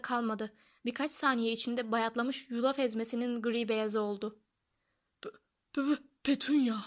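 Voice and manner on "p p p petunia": stuttering on the first sound, then blurting it; stammering. A narrator's reading. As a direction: stammer the name out in shock. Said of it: "diye kekeledi".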